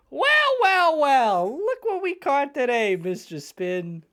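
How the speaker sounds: high gloating villain voice. Acted. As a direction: gloat like a smug crook. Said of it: "voice these characters".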